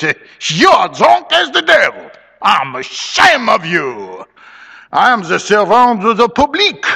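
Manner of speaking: put-on high, loud Creole French accent, tipsy bluster; mock-grand French-accented proclamation; Bienvenu. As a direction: declaim like a swaying drunken mob leader. Voices drunken Creole mob leader.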